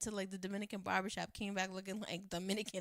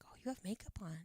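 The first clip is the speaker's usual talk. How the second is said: retold hushed remark; 7 dB quieter than usual. Boyfriend's surprised remark retold, hushed.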